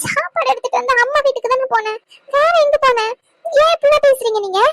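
hurt, crying self-defence; tearful protest. Acted. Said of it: "அழுகுற சீனை போட்டுருவீங்க".

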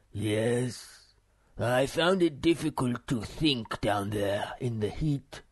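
slow, ponderous philosopher voice; dignified muskrat. A narrator's reading. Old philosopher excuses his coming to the cave, dignified. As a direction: speak slowly with great dignity. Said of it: "with great dignity".

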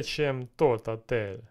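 ordinary delivery

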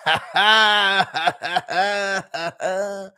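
high mock cackle; Garn's laugh. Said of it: "That's Garn's laugh".

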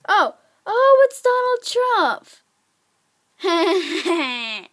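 high, loud, drawn-out cry breaking into laughter; amazed outburst. Real, not acted. Gamer discovers the figure's identity, amazed laughing shout.

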